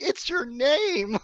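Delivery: slow, high, amused disbelief; incredulous. Retold or imitated. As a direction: stretch it out in disbelief. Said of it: "I'm like".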